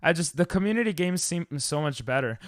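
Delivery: ordinary delivery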